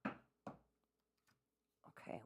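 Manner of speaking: soft whisper under the breath; whispered okay. Real, not acted. Refocusing herself before the next topic, whispered. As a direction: whisper it to yourself.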